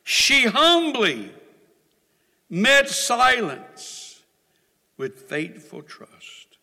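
slowed, weighty repeat; slow emphasis. Shown as a demonstration. Preacher repeating a key line slowly for the church. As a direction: lean on every word slowly. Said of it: "Can I say that again?".

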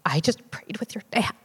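hushed, whispery imitation of a tearful man; soft, breaking half-whisper, as if through tears; retold sobbing. Husband tells wife he prayed with her dad, retold sobbing. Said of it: "just completely sobbing, he goes".

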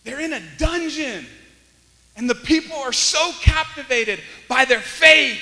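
slow, loud, stressed preaching; full-force preaching. Real, not acted. Preacher marveling at captivated prisoners, full-force preaching. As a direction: build loudly on each phrase.

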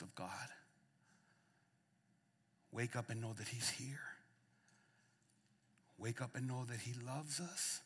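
soft, low, near-whispered appeal; hushed call. Real, not acted. Pastor urging America to wake up, softly. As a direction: plead gently with the listeners.

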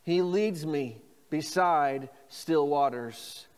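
slow, even phrase to repeat; slow call and response. Shown as a demonstration. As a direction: lead them slowly. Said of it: "say this with me".

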